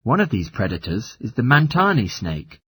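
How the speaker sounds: normal talking voice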